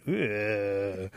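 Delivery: drawn-out "ugh" of revulsion; disgusted groan. Acted. Animals hearing about the dirty bath, disgusted groan. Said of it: "some of the animals went".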